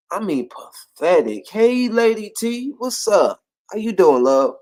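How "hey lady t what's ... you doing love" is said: bright hello to Lady T; warm greeting. Real, not acted. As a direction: call out to a friend arriving. Greeting a listener joining.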